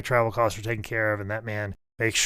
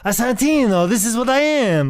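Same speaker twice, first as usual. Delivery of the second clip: high, loud mock Italian; hammed-up Santino impression; fake Italian accent; 11 semitones higher, 5 dB louder than usual. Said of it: "you talk like a fake Italian".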